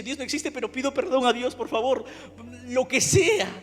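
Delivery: loud, begging, pitch up; desperate shouted plea. Retold or imitated. Son in agony begging God for relief, shouting desperately. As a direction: beg God at the top of your voice.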